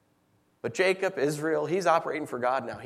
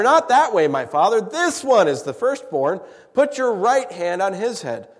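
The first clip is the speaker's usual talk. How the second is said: raised, urgent objection to his father; urgent protest; 6 semitones higher, 7 dB louder than usual. Acted. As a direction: stop him, a loud insistent correction.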